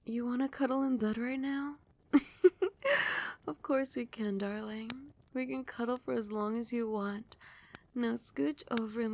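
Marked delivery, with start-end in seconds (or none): 2.1-3.4 short, high giggle; fond little laugh at a request; amused giggle. Acted. Queen to her sweetheart who wants cuddles, amused giggle.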